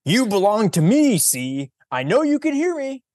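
loud and high, insistent. Acted. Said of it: "said Fausto louder still".

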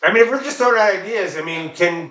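normal talking voice

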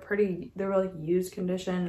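normal talking voice

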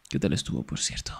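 ordinary delivery

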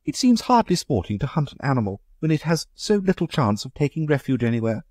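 high, light society lady asking; Amanda's voice. Acted. Amanda objects.

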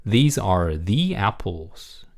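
"These are the apples" read very slowly, "the" as "thee"; slow model reading of "These are the apples" with a long ee in "the"; "thee" before apples. Shown as a demonstration. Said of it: "the apple 이렇게 되는 거죠".

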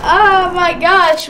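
ordinary delivery

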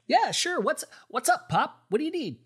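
put-on a keen kid ready to help; eager young son. Hermes answering his father Zeus in a high eager boy's voice.

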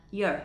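"your" weakened to a "yer" schwa; reduced you're. Shown as a demonstration. Teacher showing the further reduction, "your" as "yer". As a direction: say "your" with a neutral schwa vowel. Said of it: "thì chuyển về âm ờ".

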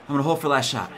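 normal talking voice

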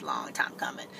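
whispered, almost no voice "long time coming"; whispering. Real, not acted. Repeats phrase in a whisper.

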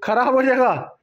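high, panicked pleading; panicked shout. Real, not acted. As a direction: yell to stop him, worried. Panicking over phone.

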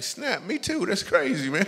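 high, delighted mock surprise; mock amazement. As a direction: act thrilled at the coincidence. Mock delight at Bible origin.

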